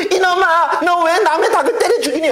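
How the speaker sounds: put-on a girl shrieking at the boy over his dead hen; angry girl's scold. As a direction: scold him like a furious country girl.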